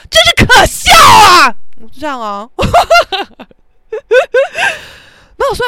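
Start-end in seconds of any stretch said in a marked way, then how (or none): 0.1-1.5 slow, loud, higher taunting yell. Retold or imitated. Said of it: "边大叫". 2.5-3.4 high, loud burst of laughter falling off. Real, not acted. Own laugh after the story.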